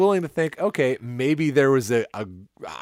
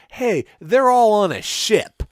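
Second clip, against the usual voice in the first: high, loud and slow mock announcement; mocking exclamation; 9 semitones higher, 7 dB louder, slower than usual. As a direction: ham it up like a dumb trailer. Mocking what the trailer shows.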